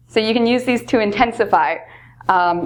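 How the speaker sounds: ordinary talk, nothing marked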